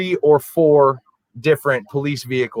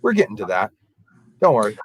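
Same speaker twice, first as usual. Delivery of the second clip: lower-pitched officer's reassurance; imitating a cop lowering his voice; dropped voice; 4 semitones lower, faster than usual. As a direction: drop your voice to put someone off. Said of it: "drops his voice and says".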